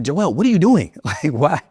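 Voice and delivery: mock exasperation at a stubborn kid; a retold, lightly hammed-up frustrated question; frustrated dad. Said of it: "I got a little frustrated".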